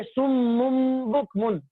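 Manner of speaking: سم مم بکمن slowly, the میم held with a nasal hum; slow recitation demo. Shown as a demonstration. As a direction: model سم مم بکمن for the class to repeat. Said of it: "آپ پڑھیے". (سم مم بکمن کو غنّے کے ساتھ آہستہ پڑھنا)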